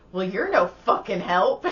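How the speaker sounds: mock annoyed retort.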